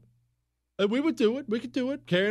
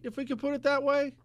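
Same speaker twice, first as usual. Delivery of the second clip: wry understatement; 10 semitones higher, much faster than usual. Acted.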